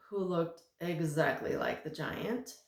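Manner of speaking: ordinary delivery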